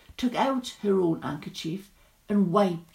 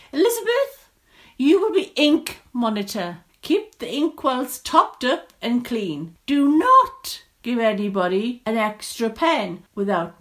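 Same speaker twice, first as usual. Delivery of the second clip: loud, high schoolmistress giving orders; shrill teacher; 4 semitones higher, 6 dB louder than usual. A narrator's reading. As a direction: lay down the rules to a pupil. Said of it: "their teacher's shrill voice".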